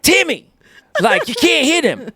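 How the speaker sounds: high, exasperated mom voice; scolding mom. Acted. Mom tells off Timmy for hitting, high-pitched mock scolding. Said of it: "So the mom is like".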